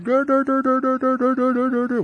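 high, silly mocking chant; mocking dur dur. Kids mocking the common-sense guy, high silly dur chant. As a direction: tease him like dumb kids. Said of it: "We're like".

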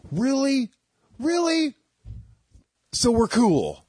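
high incredulous squeak; incredulous guy. Retold or imitated. Guy getting an apology after the mix-up, high incredulous squeak. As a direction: squeak out disbelief.

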